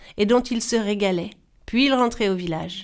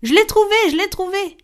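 much higher, joyful cry of discovery; excited exclamation; 11 semitones higher, 5 dB louder than usual. Acted. Sister calling down from the tree, thrilled. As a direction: shout it out, thrilled, from the treetop. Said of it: "le deuxième s'exclama".

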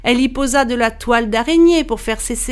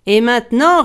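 louder, mildly roaring villain; roared threat; 3 semitones higher, 6 dB louder than usual. A narrator's reading. Bluebeard at the terrible door to his wife, mild roar. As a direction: punch it out with rising menace. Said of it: "rugit-il".

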